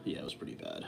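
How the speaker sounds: softly whispered, half to himself; whispered aside. Acted. Streamer admits to chat the food was bad, whispered. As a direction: whisper it as a guilty aside to viewers.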